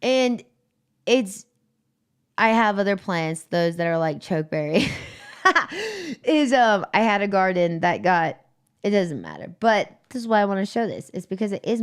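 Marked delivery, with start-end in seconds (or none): 4.9-6.2 high burst of real laughter; bright real laugh. Real, not acted. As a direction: let a genuine laugh break out. Laughing at own joke.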